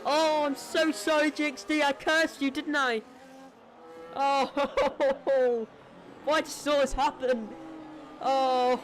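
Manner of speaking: guilty, half-laughing regret; upset apology. Real, not acted. Apologises to crashed driver.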